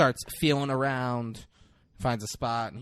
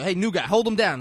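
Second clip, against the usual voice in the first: louder, higher barked command; mock shouted order; 8 semitones higher, 9 dB louder, faster than usual. Cop calling to the rookie, mock shouted order. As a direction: call out an order over a raid.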